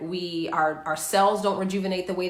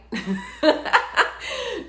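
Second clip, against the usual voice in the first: laughing; 12 semitones higher than usual. Real, not acted. Laughs at own joke.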